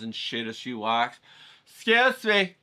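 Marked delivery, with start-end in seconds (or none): 1.7-2.6 higher, louder, insistent lady interrupting; pushy lady. Retold or imitated. Turbaned panhandler lady calling out to the painter, insistent and higher. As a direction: tap a stranger and demand attention, lady voice. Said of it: "And it's this lady, right?".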